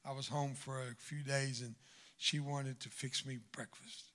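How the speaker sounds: normal talking voice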